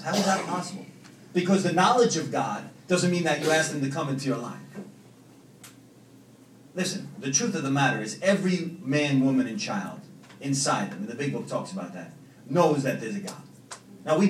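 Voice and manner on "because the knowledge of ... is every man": ordinary talk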